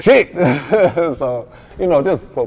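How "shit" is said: loud laugh breaking through the word. Real, not acted. Rapper laughing at his cousin's reaction, loud laugh. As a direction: crack up mid-sentence.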